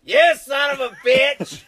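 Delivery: high, loud yell through laughter; laughing mock shout. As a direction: yell it at him, half laughing. Uncle yells as bottle finished.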